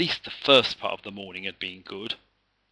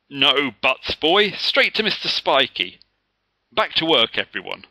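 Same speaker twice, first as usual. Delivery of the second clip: loud, high firm command; teacher ordering the boy to the head; stern order; 4 semitones higher, 7 dB louder than usual. A narrator's reading. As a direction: cut him off sternly.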